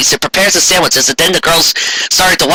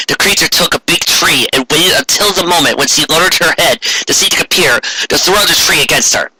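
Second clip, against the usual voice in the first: ordinary delivery, nothing marked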